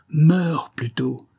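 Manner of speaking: high, drawn-out heroic cry; exclaimed. A narrator's reading. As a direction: cry it out slowly, pitch well up. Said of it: "s'écria-t-il". (exclamation héroïque, lente et haute)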